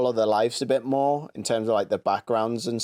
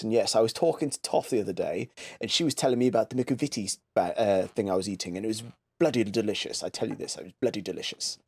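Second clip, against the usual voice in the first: mock posh Chelsea accent, trailing off softer; posh English accent; 4 dB quieter than usual. Shown as a demonstration. Mimicking Made in Chelsea cast for a friend, posh accent. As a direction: chatter like a posh Chelsea socialite. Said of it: "the posh version of all of these".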